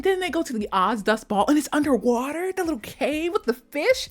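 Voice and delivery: usual speaking voice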